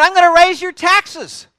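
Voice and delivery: loud, high-pitched shout to a crowd; harsh royal proclamation. Retold or imitated. New king threatening his people with taxes, harsh loud shout. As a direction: thunder it out as a domineering king. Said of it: "King answered the people harshly".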